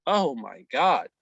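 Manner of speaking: normal talking voice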